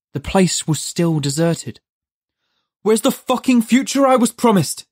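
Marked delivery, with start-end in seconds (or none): 2.8-4.9 high, loud demand; angry demand. A narrator's reading. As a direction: Judd demands the future into the empty street. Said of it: "he demanded".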